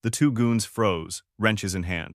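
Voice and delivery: plain reading voice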